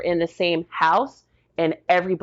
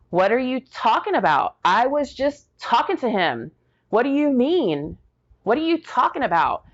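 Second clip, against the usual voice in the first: higher-pitched, incredulous questioning; bewildered protest, pitched up; retold stunned disbelief; 6 semitones higher than usual. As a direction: act out refusing to believe dire news. Retold disbelief at dire news.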